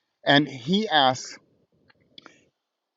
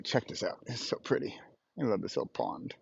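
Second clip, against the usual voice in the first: hushed; 4 semitones lower, 13 dB quieter than usual. Real, not acted. Quietly showing the pond.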